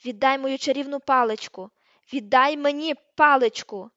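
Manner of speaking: loud, forceful order, slowing down; furious demand. A narrator's reading. Hermione demanding her wand, furious and loud. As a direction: demand it back, hammering the words.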